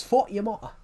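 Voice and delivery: imperfect imitation of a Geordie lecturer; attempted Geordie accent. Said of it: "I can't do his accent".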